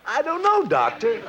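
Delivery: helpless patient's high squeak of a voice; odd high patient voice. Acted. Patient complains to the doctor about his voice, oddly high-pitched. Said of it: "when I started talking like this".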